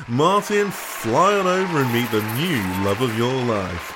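ordinary talk

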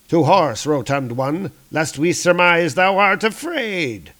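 lightly voiced heroic challenge; taunting warrior. Warrior goading his rotund comrade to ride, taunting.

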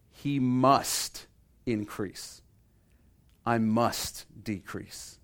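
slow, deliberate weight on "must"; measured emphasis on each "must"; emphatic. Retold or imitated. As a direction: stretch "must", pausing before "increase" and "decrease". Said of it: "what I love is John's emphasis".

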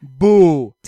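loud booing with a laugh; mock boo. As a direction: boo it like a heckler. Booing the kids' books.

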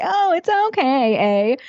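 lilting Canadian "eh" ending; mock-polite Canadian accent. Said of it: "You're like".

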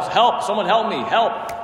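urgent mock cry for help; yelling for help. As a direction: call up for someone to help. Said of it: "he starts yelling up for help".

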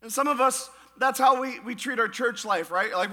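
ordinary talk

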